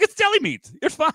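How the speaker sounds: high, loud mock reassurance; mock self-talk. Reassuring himself about the Arby's deli meats, high and hammy. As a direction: talk yourself into it, hammed up.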